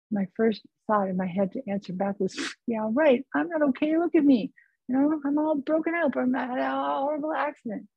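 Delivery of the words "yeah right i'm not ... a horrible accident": higher pitched, indignant retort; exasperated protest. Retold or imitated. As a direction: push back as if it's absurd. Her answer back, hurt and upset.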